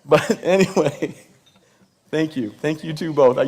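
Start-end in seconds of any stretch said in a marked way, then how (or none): none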